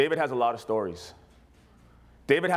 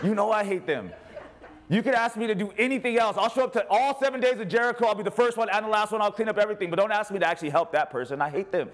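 laughing, higher mock protest of a churchgoer; mock whiny congregant; 7 semitones higher than usual. Pastor voicing a member refusing to help, laughing mock plea. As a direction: ham up the reluctant member pleading. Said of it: "the look on their face like".

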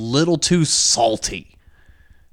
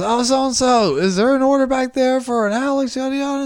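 higher, lightly voiced worker calling back to the kitchen; mock employee call; 11 semitones higher than usual. Imitating the clerk calling to the kitchen, raised higher voice.

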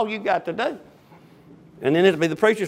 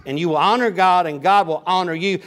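ordinary delivery

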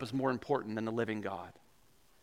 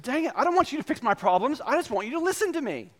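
upset wife; 11 semitones higher, faster than usual. Retold or imitated. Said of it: "She said".

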